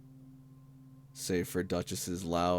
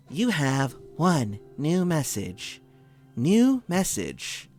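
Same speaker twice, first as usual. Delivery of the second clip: slow, robotic voicemail announcer; automated phone voice; 7 semitones higher, 4 dB louder, slower than usual. Acted. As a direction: read it like an answering machine. GM imitates the phone voicemail system.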